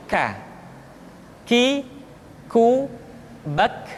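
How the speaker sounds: aspirated k in "K. Ki, ku, bak"; souffle qui coule. Shown as a demonstration. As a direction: let breath flow after each k in "K. Ki, ku, bak". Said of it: "nafas yang mengalir".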